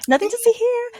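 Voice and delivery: very high singsong cover-up; mock-innocent, high-pitched dismissal; feigned innocence. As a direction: chirp it as if all is fine. Retold cover-up to coworker.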